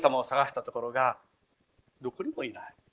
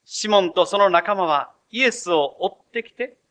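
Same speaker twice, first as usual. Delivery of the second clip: ordinary talk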